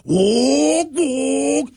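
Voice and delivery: high-pitched rushing-wind noise; mock wind sound, high and thin; high wind whoosh. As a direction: make the wind rushing past the car. Wind noise as the car takes off.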